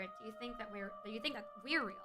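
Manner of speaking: normal talking voice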